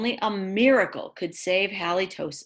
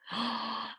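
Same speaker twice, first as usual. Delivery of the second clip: gasp; 4 semitones higher, 10 dB quieter than usual. Acted. Gasp before Grandma scene.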